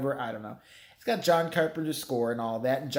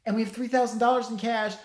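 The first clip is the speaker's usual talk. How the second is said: lightly voiced woman pitching an offer, higher; imitated podcaster woman; 7 semitones higher than usual. British podcaster offers cash to Laurie, lightly mimicked woman. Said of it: "then the woman's like".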